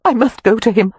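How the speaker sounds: desperate to go; tearful urgency. A narrator's reading. Amelia desperate to reach Cam, tearful urgency. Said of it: "Tears sprang to Amelia's eyes".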